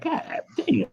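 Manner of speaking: imitated awestruck exclamation, pitched high; acted amazed reaction. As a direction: squeak it out as if stunned by a picture. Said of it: "I'm looking at her profile".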